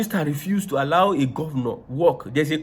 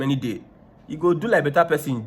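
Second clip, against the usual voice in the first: normal talking voice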